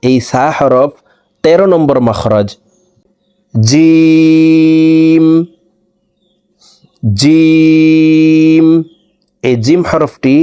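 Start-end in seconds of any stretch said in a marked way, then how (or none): 3.5-8.7 জিম held very long on one level, higher pitch; four alif stretch. Shown as a demonstration. Teacher showing the four-count stretch, long and level. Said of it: "চার আলিফ টেনে পড়তে হবে".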